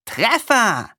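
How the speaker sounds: loud, high triumphant cry; loud and high, proud of the hit; calling out. A narrator's reading. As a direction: shout it out as the arrow hits. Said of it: "rief er".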